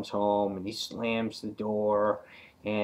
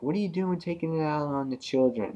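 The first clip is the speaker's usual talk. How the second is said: higher mock wife scolding; hammed-up wife scolding; 7 semitones higher, faster than usual. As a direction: tell him off like a stern sixties mom. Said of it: "Wilma's just like".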